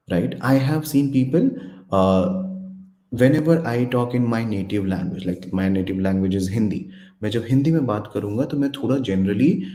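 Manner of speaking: ordinary delivery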